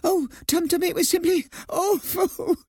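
high, hammed-up mouse sobbing. A narrator's reading. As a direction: wail it tearfully in a squeaky voice. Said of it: "Nutmeg sobbed".

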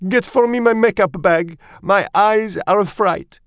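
put-on very high, loud, vain French-accented voice; French mother mouse. A narrator's reading. Mother sends son for makeup, high dramatic dismay. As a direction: order it like a fussy French diva. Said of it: "She was a French mouse".

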